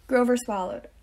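usual speaking voice

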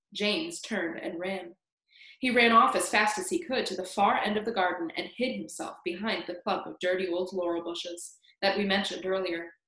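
usual speaking voice

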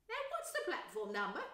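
lightly voiced busy mother; a hint of a bustling mum; motherly voice. Mother asks children.

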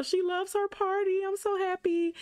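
high, happy squeal; delighted. Real, not acted. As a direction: beam with joy. Delighted Sim loves party.

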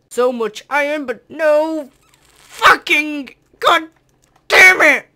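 real frustrated shout building to a scream; furious yell. Real, not acted. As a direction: explode at the useless iron. Finds only iron, rages.

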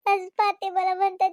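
ordinary talk

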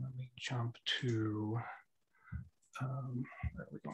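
half-whispered aside while clicking around; muttering to himself. Real, not acted. Presenter muttering to himself while finding the page, near whisper. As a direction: drop to a near whisper, thinking aloud. Navigating screen, talking to himself.

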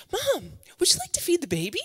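hopeful ask. Retold or imitated. Tired mother eagerly asking her mom to feed the baby. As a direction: hand off the chore sweetly. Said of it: "I'd be like".

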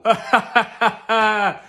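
a hearty laugh at his own joke; pleased with himself, cackling at his own gag. Acted.

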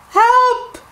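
high, panicky call for help; crying for help. Acted. Peppa falling off the sled, crying for help. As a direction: yell for help while falling off. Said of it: "she cried".

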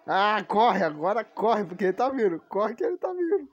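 panicked shouting, run; being chased. Real, not acted. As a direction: yell at them to flee. Mob coming, urging to run.

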